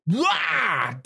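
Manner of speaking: high yelp as he throws it; a sudden high cry of effort; mock startled yelp. Sheriff heaving a moose head, high yelp.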